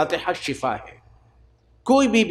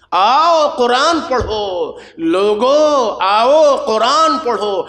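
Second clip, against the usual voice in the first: calling out; 4 semitones higher, 4 dB louder, slower than usual. Real, not acted. Preacher calls people to Quran.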